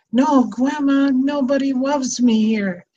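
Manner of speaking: put-on a child's voice, sad and small; little grandson. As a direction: play the hurt grandson pleading to his grandma. Grandmother voicing the crying grandson.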